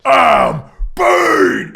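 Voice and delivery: slow, loud villain bellow; Bane roar. Acted. GM as Bane bellowing at Nightwing, slow roar. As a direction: roar it out like a hulking brute.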